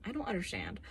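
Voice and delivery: hushed, whispered confusion; soft voiceless bafflement; baffled whisper. Retold or imitated. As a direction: whisper it as if totally lost. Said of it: "and I'm like".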